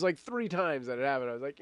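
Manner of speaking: ordinary talk, nothing marked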